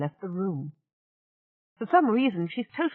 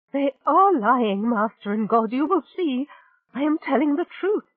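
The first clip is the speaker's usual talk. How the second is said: desperate pleading; 7 semitones higher, 5 dB louder than usual. Acted. Rachel pleading with Belazir to believe her. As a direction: struggle to sound calm.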